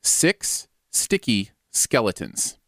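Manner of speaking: slow, deliberate tongue twister; slow demo. Shown as a demonstration. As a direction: spell out each word slowly. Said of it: "No, six, the number".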